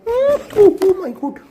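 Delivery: sudden high-pitched gasp of surprise; squealing oh my God as the pet moves; startled oh my God. Real, not acted. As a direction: jump at it, voice shooting up high.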